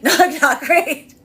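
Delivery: amused "not, not great" with a laugh. Real, not acted. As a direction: laugh out the verdict in a squeaky high voice.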